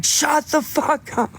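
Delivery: high, slow, furious bark of an angry father; angry dad. Retold or imitated. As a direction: snap at him like a fed-up dad. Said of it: "and my dad's like".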